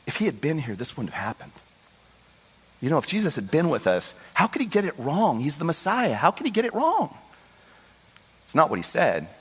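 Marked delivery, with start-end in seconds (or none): 0.1-7.2 distraught sisters questioning. Retold or imitated. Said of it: "Mary and Martha are having a conversation".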